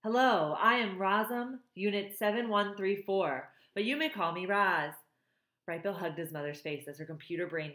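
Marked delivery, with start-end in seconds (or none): none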